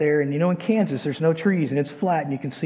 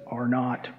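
lightly imitated man of few words; low, terse two-word verdict; laconic John; 4 semitones lower than usual. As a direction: say it low, as if rarely speaking. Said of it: "when John doesn't speak much".

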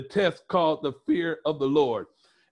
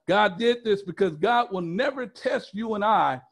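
usual speaking voice

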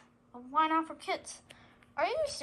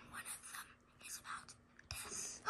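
whisper; 18 dB quieter than usual. Acted. Dreadful confession, hushed.

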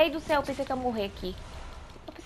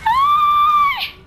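sharp high startled yelp; startled scream; 13 dB louder than usual. Real, not acted. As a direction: yelp in sudden fright. Sudden scare.

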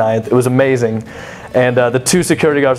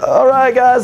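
much higher called-out reply; calling back to fans; 10 semitones higher, slower than usual. Retold or imitated. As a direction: shout it across to the fans.

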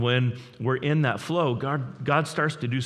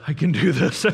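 louder, strained desperate repeat; strained mock cry; 4 semitones higher, 7 dB louder than usual. Dad in the grocery store pleading with himself, strained and louder. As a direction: push it out like a dad at his limit. Dad's punchline, said twice.

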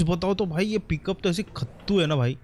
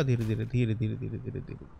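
low murmur, fading away; careful coaxing; 5 semitones lower, 7 dB quieter than usual. Real, not acted. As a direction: coax it along, softer and softer. Coaxing the vehicle along slowly.

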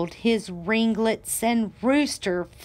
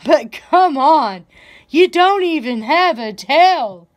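mocking laugh; 6 semitones higher, 7 dB louder than usual. A narrator's reading. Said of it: "laughed Donkey".

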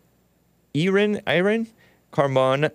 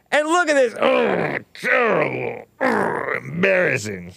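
mock horror; 7 semitones higher than usual. Real, not acted. Mocking commentary on a photo.

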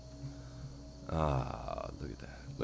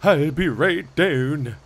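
slow, drawn-out mock Nordic accent, higher and louder; 8 semitones higher, 8 dB louder, much slower than usual. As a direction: echo the guard's line in a hammed-up Nordic accent. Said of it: "perfect for my accents".

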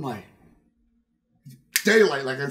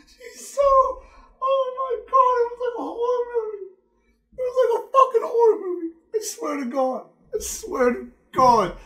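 much higher, crying-voiced mock terror; horrified shriek. Telling the crowd about the chase, shrieking in mock horror. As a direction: squeal it like a horror movie victim.

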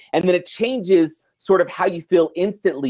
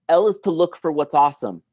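usual speaking voice

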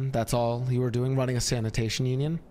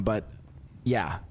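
ordinary talk

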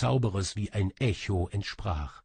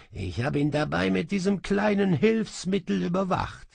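higher, pleased gloating magician; smug sorcerer; 7 semitones higher, 3 dB louder than usual. Acted. Sorcerer gloats to the thief, high and pleased. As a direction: show off how you caught her. Kaba explains smugly.

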